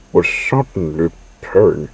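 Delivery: plain reading voice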